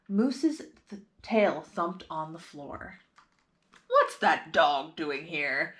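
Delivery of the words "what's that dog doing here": lower, slow principal's demand; a principal spotting a dog in school; gruff principal. A narrator's reading. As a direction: question it like a stern principal. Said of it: "asked Principal Evans".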